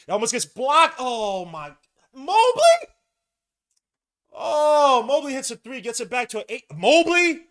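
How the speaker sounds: loud, high-pitched disbelief at a made shot; shocked yelling. Real, not acted. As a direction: yell it like a stunned fan. Live reaction to opponent's three.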